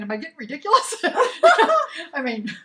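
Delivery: laughing at himself. Real, not acted. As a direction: laugh through the words. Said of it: "And I'm thinking".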